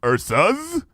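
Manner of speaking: high rising royal question; puzzled question. Acted. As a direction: query the plural in surprise. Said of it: "said Luna".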